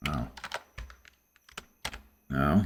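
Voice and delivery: ordinary talk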